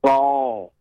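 "Saul" with a plain L at the end; Saul for saw. Retold or imitated. Caller repeating the Akron "Saul". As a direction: finish "Saul" on an L sound. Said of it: "they say Saul with an L on the end".

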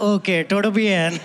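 put-on Indian accent on todo bien; a light Indian accent, casual; Indian-accented Spanish reply. Boy answering his Spanish classmate, Indian accent. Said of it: "speaking Spanish in an Indian accent".

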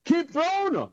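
high-pitched imitation of an irate coach calling out; coach hollering. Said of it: "B A popped up and was like".

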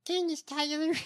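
high-pitched put-on politician voice; candidate's mocked voice. Host trying a name in the candidate's voice, high mocking impression. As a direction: pitch it way up, mimicking her odd voice. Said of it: "With that voice?".

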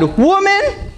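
high, sharp scolding "Woman!" with a rising snap; sassy attitude. Retold or imitated. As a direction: snap "Woman!" at your mom like a cheeky son. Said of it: "giving a little bit of attitude to his mom".

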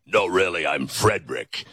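a woman faking a deep voice; mock low disguise voice. Host voicing a woman pretending to be a man. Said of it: "Nobody said".

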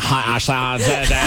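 hammed-up Valley Girl greeting; mimicked Valley Girl accent. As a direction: echo her California accent over the top. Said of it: "I thought you were doing a character voice".

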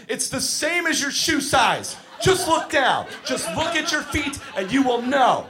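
high, shouted plea, laughing through it; exasperated yelling. Retold or imitated. Camp counselor yelling at kids about skate sizes, exasperated. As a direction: yell at the kids to look at their feet. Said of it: "just going".

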